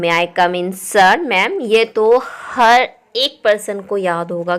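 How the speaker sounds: ordinary talk, nothing marked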